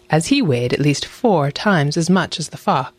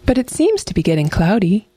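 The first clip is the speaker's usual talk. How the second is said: higher-pitched fox thinking aloud; lilting, self-excusing storybook fox; crafty fox, musing; 5 semitones higher than usual. A narrator's reading. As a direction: reason it away lightly. Fox's voice in narrated tale.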